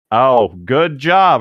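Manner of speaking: slow, loud mock congratulations; sarcastic praise. Real, not acted. Mocking the character's confession, slow sarcastic praise. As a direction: drawl it out mockingly.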